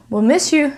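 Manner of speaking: "miss you" sounding almost like "mishoo"; connected S plus Y. Shown as a demonstration. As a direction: blend "miss you" so it ends like shoe. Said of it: "It almost sounds like shoe".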